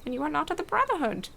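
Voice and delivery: a quick, hammed-up Bane; mock masked villain menace; Bane impression. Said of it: "I'm doing my best Bane impression".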